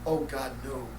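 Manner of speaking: low, understated dismay; mild distressed cry. A narrator's reading. Wife hearing of an accident at the brewery, understated cry. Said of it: "cries Brenda".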